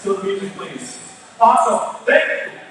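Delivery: ordinary talk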